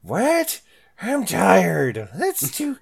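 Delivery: put-on high, loud, cranky old-timer voice; hammed-up elderly whine; tired geriatric adventurer. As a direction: complain like a worn-out old adventurer. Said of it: "and be like".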